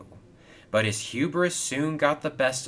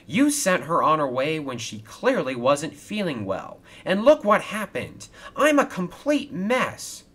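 high-pitched, aggrieved outburst; complaining; 7 semitones higher, 4 dB louder than usual. A narrator's reading. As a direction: build to a higher, louder protest. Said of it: "he complained".

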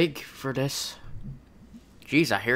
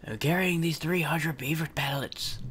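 whispered aside; 2 semitones lower, 8 dB quieter than usual. Acted. Actor muttering an aside during the play, whispered. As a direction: whisper the line under your breath.

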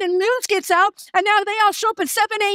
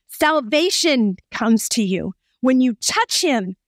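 ordinary talk, nothing marked

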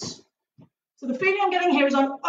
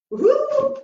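much higher celebratory whoop; excited whoop; 11 semitones higher than usual. Real, not acted. Instructor celebrating a good partner stretch, high whoop. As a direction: cheer the partner's success.

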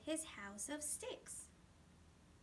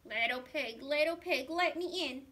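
loud, gruff big bad wolf; 8 dB louder than usual. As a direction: call out like the wolf at the door. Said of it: "said the wolf".

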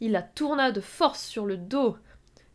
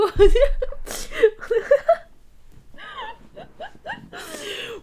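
long high real laughing fit; helpless laughter; 9 dB louder than usual. Real, not acted. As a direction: laugh uncontrollably until out of voice. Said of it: "je devrais pas rire". (long fou rire aigu)

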